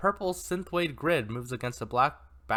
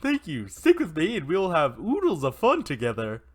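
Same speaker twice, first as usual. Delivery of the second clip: cheerful cartoon pig voice; 3 semitones higher, 3 dB louder than usual. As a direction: promise fun like a sunny mascot. Pig voice continues.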